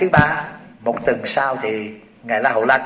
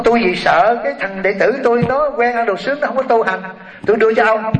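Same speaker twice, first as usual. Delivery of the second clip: a hint of a higher, pleading elder monk; Sariputta's earnest plea; 8 semitones higher than usual. Sariputta asking Kassapa to take his pupil, lightly voiced. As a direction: voice the teacher handing over his pupil, a touch raised. Sariputta answers in quoted dialogue.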